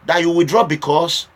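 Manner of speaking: ordinary talk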